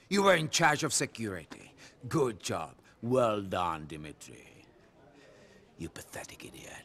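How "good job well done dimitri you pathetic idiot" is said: low, quiet menace fading into a whisper; contemptuous sarcasm. Acted. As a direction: let the praise sink into whispered scorn. Boss sneers at security man.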